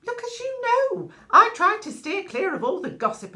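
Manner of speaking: loud protest, starting very high; indignant self-defence. Acted. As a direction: protest your innocence, theatrically. Gossip protesting her own innocence.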